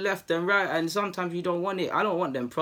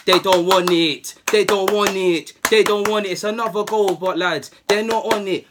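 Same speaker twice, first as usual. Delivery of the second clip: taunting shout; 4 semitones higher, 8 dB louder than usual. Retold or imitated. Footballer jeering the beaten team after a goal, loud mocking taunt. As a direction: jeer it right in their faces.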